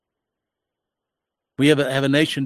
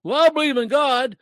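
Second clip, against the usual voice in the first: much higher mock profession of faith; mock professed believer; 9 semitones higher than usual. Preacher mimicking those who say they believe in God, mocking. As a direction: pitch it way up, echoing an empty claim. Mimics nominal Christians' empty claim.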